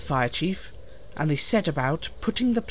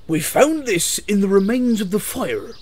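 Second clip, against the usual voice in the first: loud, higher farmer character voice; raised, put-on farmer voice; Farmer Giles; 5 semitones higher, 5 dB louder than usual. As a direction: announce the find loudly. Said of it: "said Farmer Giles".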